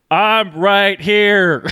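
loud, high, drawn-out holler; hammed-up exasperated call; mock shouting back. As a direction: yell it slowly at the screen.

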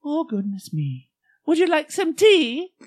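put-on very high, slow old-lady falsetto; Mrs Doubtfire falsetto. Playing the Scottish nanny serving tea, high old-lady falsetto. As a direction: coo it in a sky-high granny voice. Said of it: "You're doing Missus Doubtfire".

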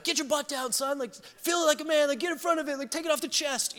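high, hammed-up coaching dad; dad barking orders. Dad hitting balls at his son, mock yelling. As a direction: yell like a dad at batting practice. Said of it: "He'd be like".

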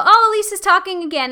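high, loud, quick dismissive sigh of a bored listener; mock eye-roll. Mocking her listeners who tune her out, loud and dismissive. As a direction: toss it off like you've heard it all before. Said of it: "please don't be people that go".

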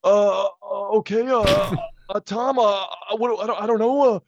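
flustered awkward giant; high hesitant Greg voice; Nicholas Braun as Cousin Greg. Pretend. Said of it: "Then, then you go Nicholas Braun".

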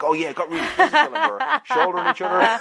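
high, fast and giggly; laughing. Retold or imitated. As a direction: boast it through laughter. Boys boasting, laughing high.

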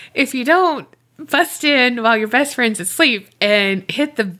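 ordinary talk, nothing marked

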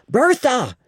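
high desperate call; calling out a name. A narrator's reading. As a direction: call her name from a distance, urgently. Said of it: "We heard him call".

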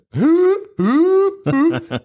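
high, hooting mock laugh; mom's annoying laugh. Mocking the movie mom's laugh, high whooping imitation. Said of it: "listen to her laugh like that".

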